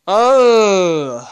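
startled oh. Real, not acted. Sudden loud exclamation while cleaning.

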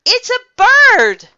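very high, loud excited yell; amazed shout. A narrator's reading. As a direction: cry out a sudden sighting. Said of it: "Michael shouted".

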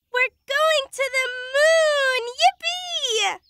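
high-pitched, drawn-out whoop of joy; overjoyed celebration; gleeful cheer. Acted. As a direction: cheer it out, stretching the big words. Excited cheer about moon trip.

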